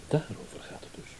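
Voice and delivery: hushed, breathy realisation under the breath; a quiet aside; whisper. Acted. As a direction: whisper it as the penny drops. Hushed realisation in a tense scene.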